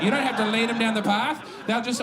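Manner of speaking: normal talking voice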